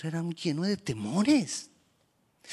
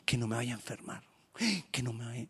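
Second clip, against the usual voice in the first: mock worried murmur; 4 semitones lower, 8 dB quieter than usual. Voicing a worried believer.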